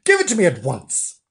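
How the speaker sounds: Mister Flange raging at the snowman. Acted. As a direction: demand the hat back furiously. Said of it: "he said in a rage".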